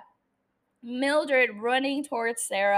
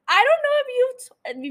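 laughing; 12 semitones higher, 6 dB louder than usual. Real, not acted. Laughing at her own rant.

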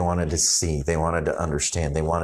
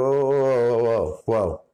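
mock whoa; 5 semitones higher than usual. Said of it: "And you just go".

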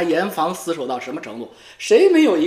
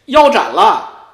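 usual speaking voice

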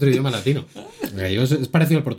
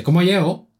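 raised-pitch Asturian greeting; 7 semitones higher, 3 dB louder than usual. Shown as a demonstration. Showing how someone greets you, in Asturian speech. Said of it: "alguien que te dice".

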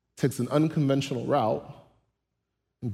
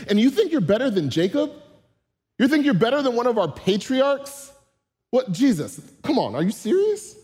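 higher and louder, mock-outraged disbelief; incredulous disbelief; 6 semitones higher, 3 dB louder than usual. Woman at the well scoffing at Jesus, incredulous and raised. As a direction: play her scoffing at a man with no bucket.

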